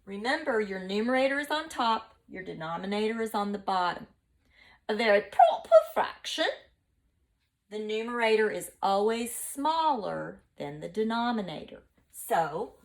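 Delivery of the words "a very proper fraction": posh British. Shown as a demonstration. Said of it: "your British accent".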